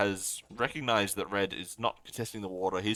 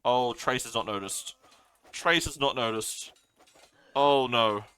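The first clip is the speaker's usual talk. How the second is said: louder and louder, higher, voice rising in panic; excited alarm; 4 semitones higher, 8 dB louder, slower than usual. Real, not acted. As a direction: caster watching a disaster unfold, groaning "oh no". Player's villagers dying unnoticed.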